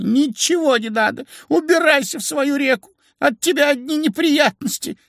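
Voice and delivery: put-on very high old-woman wail; upset old woman. A narrator's reading. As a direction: send it packing in a high, upset voice. Old woman sends the fish away. (старушечьи причитания высоким голосом)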